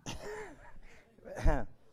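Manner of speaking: a burst of high laughter; loud laugh. Real, not acted. Preacher laughing at his own joke about Louis, high and loud.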